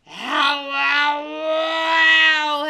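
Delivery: loud rising yelps of pain; pained coyote howl. Acted. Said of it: "howled he Coyote".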